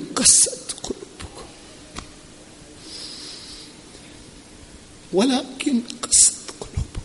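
recitation fading to a choked near-whisper; overcome with emotion. Real, not acted. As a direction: let the voice give out as you recite. Preacher overcome while repeating the verse.

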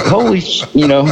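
high-pitched retold astonishment; sudden realization. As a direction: relive the moment it dawns on you. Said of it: "And then I woke up".

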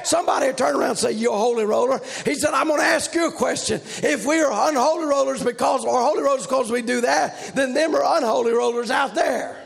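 shouted, fast preacher's delivery; preaching at full force. Acted. Preacher rousing the church, shouting preaching. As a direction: whip the congregation up.

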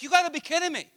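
high exasperated imitation of a kid; really frustrated. Five-year-old exasperated at Israel, imitated frustration. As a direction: act out childish indignation. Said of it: "he was really frustrated".

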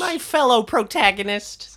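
squeaky slowed-down dude voice; high silly bro voice. Pretend. High mock voice again.

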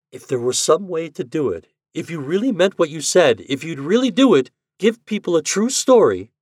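high, eager plea; pleading. A narrator's reading. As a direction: beg him, hope rising. She pleads excitedly.

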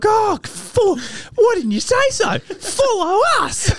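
very high, loud, laughing mock fan voice; gushing welcome. Staff waving the lads through, high laughing gush. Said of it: "And they're like".

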